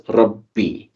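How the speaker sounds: Rabbi with double b straight into ee; correct smooth pronunciation. Shown as a demonstration. Teacher gives the final pronunciation, smooth Rabbi ending in ee. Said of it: "So you pronounce it as".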